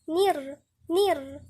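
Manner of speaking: نير with a clean "i" straight after the n; careful syllable drill on نير; clear kasra. Shown as a demonstration. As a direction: keep the vowel of نير a true "i". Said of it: "نكسر كويس النون".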